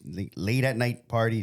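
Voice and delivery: ordinary delivery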